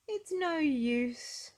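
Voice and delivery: slow, high sighing Miss Giggles. Acted. Miss Giggles sighing to Mister Happy, slow and high. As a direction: drag it out with a sigh. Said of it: "she sighed".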